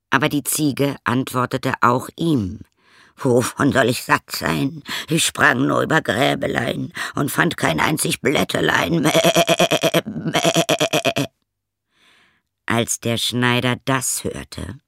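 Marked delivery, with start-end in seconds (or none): 3.2-11.3 goat voice=34. Acted. Goat lies again.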